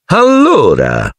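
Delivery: high, slow, drawn-out bark; slow high barked word; Aunt Marge barking. A narrator's reading. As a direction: drag out the word high and bossy. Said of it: "abbaiò".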